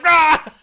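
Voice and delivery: high loud cry of rage; mock angry shriek. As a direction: shriek like an offended god. Beers gets pissed.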